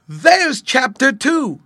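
high, loud and slow, thrilled; excited discovery. Acted. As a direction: announce the sprout with delight. Boy spots first sprout.

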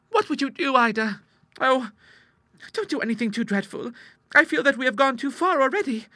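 put-on very high, worried girlish voice; anxious sister Clara. Sister fearing they went too far, high worried voice. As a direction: fret at her in high pitch.

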